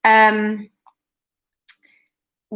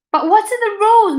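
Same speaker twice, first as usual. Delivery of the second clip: much higher, fretful question; anxious Mister Brooks; 10 semitones higher, 4 dB louder than usual. A narrator's reading. Headmaster to referee: higher, fretful plea. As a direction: plead for some order.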